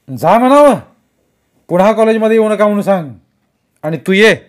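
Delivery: loud, high, fast outburst; furious shout. A narrator's reading. Kumar yelling to throw the teachers out, furious. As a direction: blast the order fast.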